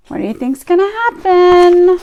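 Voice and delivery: ordinary delivery, nothing marked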